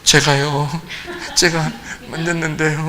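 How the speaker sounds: a touch of a frightened woman. Retold or imitated. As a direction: own up in a scared small voice. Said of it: "여자도 무서워서".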